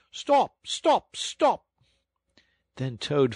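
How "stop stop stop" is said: high, loud mock shout of stop; distant shouting. A narrator's reading. Driver mimics pursuers shouting stop, loud. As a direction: call it like the far-off mob.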